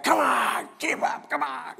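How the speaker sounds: high, strained imitation of a yell; mock yelling. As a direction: imitate a mother yelling back at her toddler, without full volume. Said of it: "she yells, screams back".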